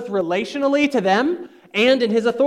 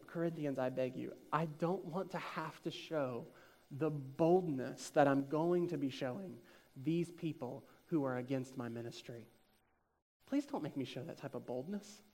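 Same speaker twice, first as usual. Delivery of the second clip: much softer, lower, gently begging; soft pleading; 6 semitones lower, 18 dB quieter than usual. Retold or imitated. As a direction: plead quietly, as Paul begging his church. Paul voiced begging the Corinthians.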